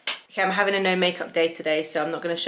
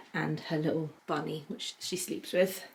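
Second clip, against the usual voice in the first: whispering; 2 semitones lower, 7 dB quieter than usual. Real, not acted. Soft aside about baby's bunny.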